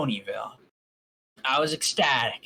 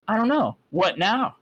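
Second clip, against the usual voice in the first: mimicking copy; 7 semitones higher, 5 dB louder than usual. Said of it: "she copied".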